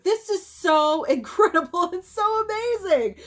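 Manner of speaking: high, thrilled gush with a laugh; giddy, high-pitched awe; excited gushing. Real, not acted. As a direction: gush in amazement, pitch soaring. Gushing over a favorite gift.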